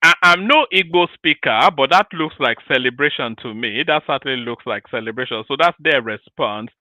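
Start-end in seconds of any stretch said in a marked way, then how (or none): none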